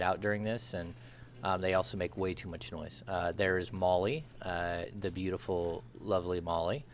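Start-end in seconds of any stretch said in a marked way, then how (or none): none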